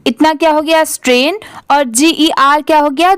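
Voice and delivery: ordinary talk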